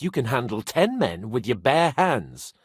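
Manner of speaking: usual speaking voice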